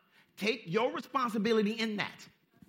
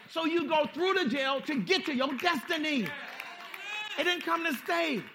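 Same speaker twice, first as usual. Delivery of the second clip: loud, high climactic shout; preaching shout; 7 semitones higher, 3 dB louder than usual. Acted. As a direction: shout it to the crowd.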